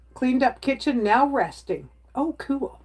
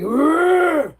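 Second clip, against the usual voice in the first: growling dog imitation; 8 semitones higher, 6 dB louder than usual. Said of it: "he sounds like a monster".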